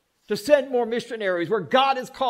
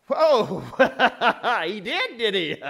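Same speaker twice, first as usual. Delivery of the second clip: chuckling amused whoa; laughing. Real, not acted. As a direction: laugh through the words. Laughing at the woman's words.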